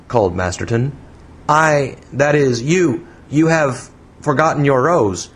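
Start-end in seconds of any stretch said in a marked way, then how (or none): 1.5-5.3 hesitant, slow, slightly raised pitch; feeble. A narrator's reading. Man calling her back over a rose, feeble. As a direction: falter awkwardly over each restart. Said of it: "he added feebly".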